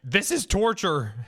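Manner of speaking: drawn-out, high-pitched mock protest; mock outcry. Streamer imitating stream chat protesting, mock outcry. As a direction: cry it out like an outraged onlooker. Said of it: "chat like".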